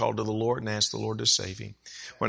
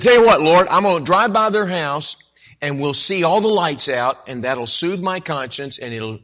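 loud bargaining; 6 semitones higher, 9 dB louder than usual. Retold or imitated. Bargaining with God, retold.

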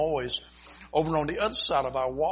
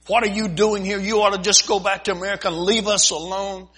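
raised, louder voice of a furious mother; angry mother; 5 semitones higher, 7 dB louder than usual. Retold or imitated. Missionary voicing the angry mother who shouts at him. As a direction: throw her anger at him, higher and louder.

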